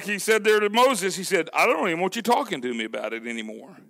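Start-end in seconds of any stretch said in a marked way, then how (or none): 1.5-3.8 low, soft and fast brush-off; low dismissive Lord. Acted. The Lord refusing Moses' talk, low and quick. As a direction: quietly wave him away.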